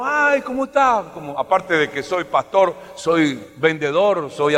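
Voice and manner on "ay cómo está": high, sing-song mock welcome; mock sweet greeting. Bakery customers greeting the pastor, high mock sweetness. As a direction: imitate the customers' put-on friendliness.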